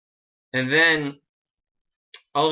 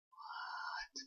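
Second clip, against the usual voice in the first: long, very soft mock-stunned whisper; 21 dB quieter than usual. Retelling the TARDIS staying put, stunned whisper. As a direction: breathe it out in acted disbelief.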